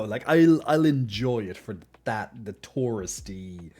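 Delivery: ordinary talk, nothing marked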